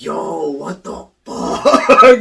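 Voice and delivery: loud, high, drawn-out disbelief; hammed-up creeped-out outburst; retold shock. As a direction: stretch it out, big and freaked. Retells creeped-out reaction.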